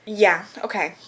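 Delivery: usual speaking voice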